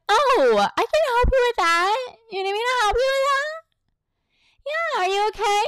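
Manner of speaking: very high, drawn-out sing-song voice; mock an over-cheerful helper in a squeaky-high pitch; syrupy mock concern, way up high; fake happy-joy helper. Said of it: "they wanna act like they've been as happy, happy, joy, joy".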